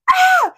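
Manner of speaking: sharp high pained scream. Retold or imitated. Narrator retelling the frame falling on her. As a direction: yelp as it hits. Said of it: "I literally went".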